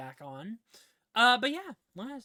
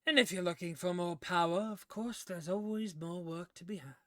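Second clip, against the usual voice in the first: put-on game character voice, inviting; business-like patron figure; 3 semitones higher, 4 dB louder than usual. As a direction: offer more work in the patron's character voice. Game master voices Mordenkainen.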